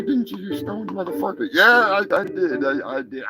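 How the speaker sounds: usual speaking voice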